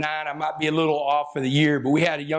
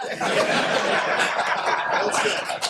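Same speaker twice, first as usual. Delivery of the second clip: real laugh; 4 semitones higher than usual. Real, not acted. Laughing at own story.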